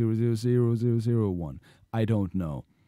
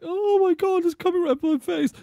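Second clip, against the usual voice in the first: very high, loud mock-panic squeal; hammed-up falsetto terror; panicking game character; 6 dB louder than usual. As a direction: squeal in pretend alarm, fast at the end. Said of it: "Look at this guy. He's like".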